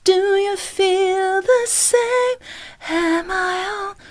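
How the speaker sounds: high slow airy sung line; diffused way, wrong. Shown as a demonstration. Coach showing what not to do going back down. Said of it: "we try not to do this".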